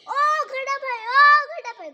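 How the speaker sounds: shouting high and loud from hiding; loud calling out. Acted. Hiding rabbit shouts to friend horse for help, loud high call. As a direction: call out loudly to a friend passing by.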